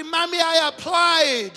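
preacher voices the boastful son, shouting; son protesting. Acted. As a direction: boast to mommy at the top of your voice. Son boasting to his mother.

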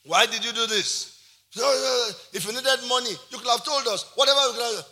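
loud retold reproach; angry scolding. Uncle calling the man out over the money, loud scolding.

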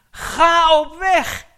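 high, loud, very slow cry; shouted command. Acted. Preacher calling his congregation to go, shouted slowly. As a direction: roar the command at the climax.

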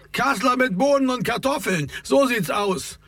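high-pitched call across the room; lightly projected heckle, no real shout; calling over. A narrator's reading. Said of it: "rief Raimund herüber".

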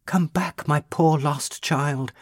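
weeping plea. A narrator's reading. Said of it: "she wept and cried".

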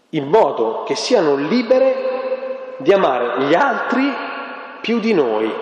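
slow, each phrase weighed; weighty quotation. Shown as a demonstration. Preacher quoting Radcliffe to the congregation, slow and weighty. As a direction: lean on "dobbiamo" and "altri", slowly. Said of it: "repeated for emphasis".

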